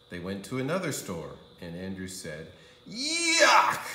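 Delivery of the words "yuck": high loud disgusted cry of yuck. A narrator's reading. Andrew rejecting the pajamas, loud disgusted yuck. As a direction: spit out a kid's yuck.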